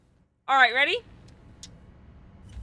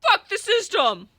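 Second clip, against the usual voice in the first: loud snarling punk shout; 7 semitones higher, 4 dB louder than usual. Acted. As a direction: yell it like a rebel. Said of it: "Smash into the door and shout".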